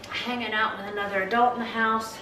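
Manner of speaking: ordinary talk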